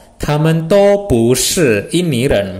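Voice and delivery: slow, careful Mandarin model phrase; teacher reads it out slowly for students; Mandarin demo. Shown as a demonstration. Teacher models Mandarin phrase slowly and clearly. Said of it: "Mereka semuanya bukan".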